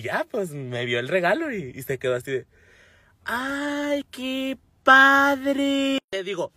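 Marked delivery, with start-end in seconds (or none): 3.2-6.0 high, lightly voiced boyfriend going through the motions; a hint of a polite, bored partner; mock unimpressed boyfriend. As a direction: fake enthusiasm, half-hearted. Imitating boyfriend's lukewarm reaction.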